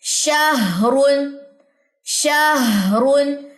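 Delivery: शाहरुन drawn out very slowly, every sound clear; शाहरुन stretched slowly like a tajweed teacher; slow recitation demo. Shown as a demonstration. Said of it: "अब इसकी example देखिए".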